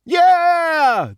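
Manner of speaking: very high, loud whoop; high-pitched jeering cheer; gang cheer. A narrator's reading. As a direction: shout it like a rowdy gang. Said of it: "they shouted".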